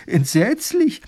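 high, loud gasp of horror; horrified. Acted. Wife reacting to her husband's dragon tale, horrified. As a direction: exclaim aghast, pitched right up.

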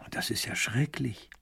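slow, shocked whisper; soft whispered horror; hushed dismay. Acted. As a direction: breathe it out, appalled. Ruler hears of the losses.

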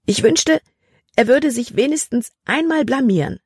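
high, swooping, resentful wish; exasperated wish. A narrator's reading. Libby silently wishes Caleb would slip up, exasperated. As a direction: wish it fervently, pitched well up.

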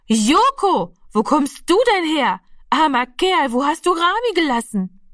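high-pitched astonished calling out; lauter, überraschter Ausruf. A narrator's reading. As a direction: call to the lost cat, high and amazed. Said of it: "rief sie so laut". (überrascht rufend, mit hoher Stimme)

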